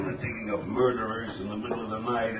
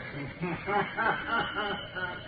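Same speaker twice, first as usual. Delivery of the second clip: eerie laugh; 10 semitones higher than usual. Acted. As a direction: laugh like a hidden menace. Eerie laugh before Shadow speaks.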